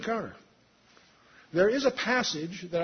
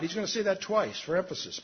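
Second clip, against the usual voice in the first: usual speaking voice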